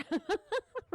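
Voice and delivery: high breathy burst of laughter. Real, not acted. Laughing at her own mock inner critic rant, high laugh.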